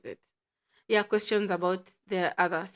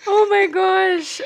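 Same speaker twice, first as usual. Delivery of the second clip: amused exasperation; 11 semitones higher, 12 dB louder, much slower than usual. Real, not acted. Reacts to cohost's failed intro.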